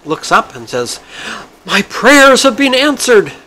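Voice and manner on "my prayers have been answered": very high, slow, loud swooning parrot; slow, high delighted parrot punchline; pious parrot. Acted. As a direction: sigh it like a prayer come true. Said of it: "the female parrot ... says".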